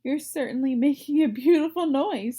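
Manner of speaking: a loud sarcastic snap at the noisy pig; irritated snap. Acted. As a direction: snap back at the crybaby, fed up. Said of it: "snapped the old sheep".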